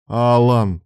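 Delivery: loud cry of his name; loud warning cry; sharp exclamation. A narrator's reading. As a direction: call him out to stop him. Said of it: "воскликнул Илья".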